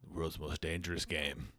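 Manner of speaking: deep, grave British villain; menacing low villain voice. Pretend. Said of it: "vaguely British accent, but very grave".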